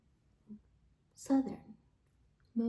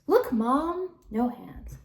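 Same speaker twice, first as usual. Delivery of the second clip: put-on much higher and louder, a kid showing off; 5 semitones higher, 8 dB louder, slower than usual. Boy calls to mom during trick riding, excited. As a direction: call out like a proud little boy.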